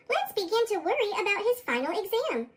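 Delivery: plain reading voice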